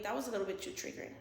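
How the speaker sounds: very soft, dropped-away real aside; self-checking murmur after an outburst; hushed aside. Real, not acted. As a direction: back off to a near whisper. Self-conscious aside after the scream.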